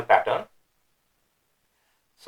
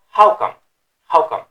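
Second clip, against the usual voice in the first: higher, louder "how come?"; rhetorical question; 5 semitones higher, 8 dB louder than usual. Shown as a demonstration. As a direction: ask it in indignant disbelief. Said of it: "it's a rhetorical question".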